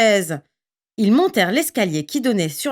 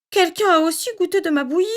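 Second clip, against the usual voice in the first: higher, quicker, gentle mother bear; storybook mama bear, soft and quick; Mama Bear's soft voice; 9 semitones higher, faster than usual. A narrator's reading. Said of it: "dit Maman Ours de sa voix douce".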